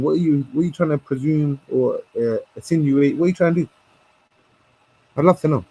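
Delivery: ordinary talk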